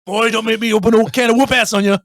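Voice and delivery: hammed-up warning, high and forceful; mock threat. Film quote threatening whoop-ass, mock angry. Same threat acted out harder.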